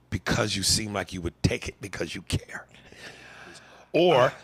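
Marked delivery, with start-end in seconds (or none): 0.1-2.7 sly whispered aside. Real, not acted. Host flirting with a listener in a whisper. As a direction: whisper it dirty to her.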